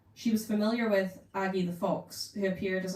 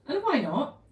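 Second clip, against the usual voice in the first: high indignant challenge; mock indignant; 9 semitones higher, 5 dB louder than usual. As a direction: toss it up, affronted. Aggie challenging.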